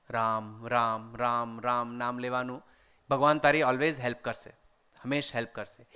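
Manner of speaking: low, soft, soothing repetition; calm a frightened child, voice dropped low; gentle reassurance. Retold or imitated. Retold nurse comforting young Mohan afraid of the dark, gently reassuring.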